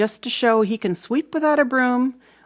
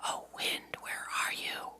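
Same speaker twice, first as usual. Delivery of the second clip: soft voiceless whisper; 16 dB quieter than usual. Acted. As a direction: whisper to find the tired wind. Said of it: "I whisper".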